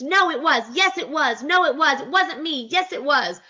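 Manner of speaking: high sing-song back-and-forth; childish-style tit-for-tat, mimicked; mock bickering. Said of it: "still going back and forth".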